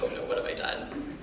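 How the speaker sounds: hushed mock alarm; under-the-breath worry, half acted; retold dismay. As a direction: softly retell an oh-no moment. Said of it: "I was like".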